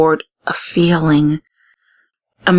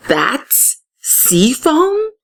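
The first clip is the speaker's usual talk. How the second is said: a slow, higher, wondering question; surprised; 7 semitones higher, much slower than usual. Acted. Amaya staring at the foam, slow surprised question. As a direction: draw it out in disbelief. Amaya sees the strange cloud.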